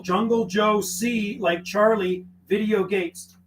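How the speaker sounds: Jungle spoken very slowly, each part set apart; slow spelled-out callsign. Shown as a demonstration. Radio operator spelling his callsign for a caller, slowly. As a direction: spell it out slowly so they can copy it. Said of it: "Did you get that?".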